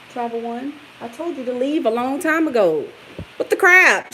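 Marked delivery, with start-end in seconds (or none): 3.4-4.1 shocked outburst. Real, not acted. Reacting to a hurtful comment.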